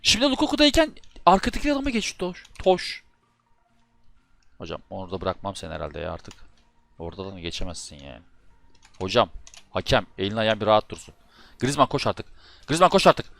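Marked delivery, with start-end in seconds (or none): 0.0-3.0 furious complaint. Real, not acted. Raging at the control switching. 12.7-13.2 louder urging. Real, not acted. Urging the player again, louder.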